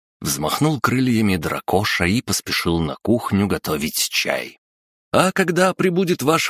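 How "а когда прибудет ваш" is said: loud high call upstairs; shouting from upstairs. A narrator's reading. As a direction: shout it up from another floor. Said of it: "крикнул со второго этажа". (громкий крик со второго этажа)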